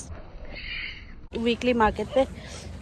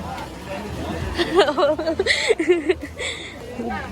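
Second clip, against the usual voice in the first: laughing; 4 semitones higher than usual. Real, not acted. Laughing after jalebi naming.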